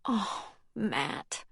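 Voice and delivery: teary. A narrator's reading. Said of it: "Peyton got tears in her eyes".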